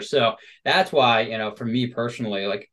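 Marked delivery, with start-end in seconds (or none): none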